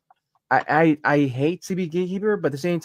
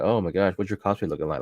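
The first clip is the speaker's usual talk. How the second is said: low, dropped, sneering fake-sweet voice; mocking put-down in a lowered voice; snide mock question; 11 semitones lower, 4 dB quieter, faster than usual. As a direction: imitate a snide heckler at an expo. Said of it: "of like".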